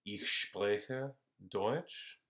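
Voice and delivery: ich spreche Deutsch said very slowly, word by word; slow teaching example; slow clear demo. Shown as a demonstration. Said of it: "puedo decir".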